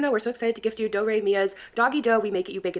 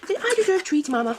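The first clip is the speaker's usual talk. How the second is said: high squeaky voice speaking as her dog; 10 semitones higher than usual. Pretend. Speaking for her dog Bruno, high cute dog voice. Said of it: "about my dog voice".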